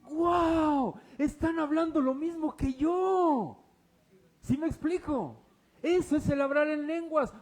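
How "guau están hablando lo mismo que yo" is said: loud, high astonished crowd voice; amazed listeners. Acted. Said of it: "Y dicen".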